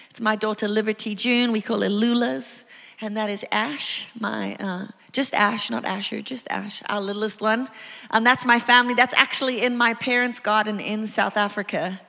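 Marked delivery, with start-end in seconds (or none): none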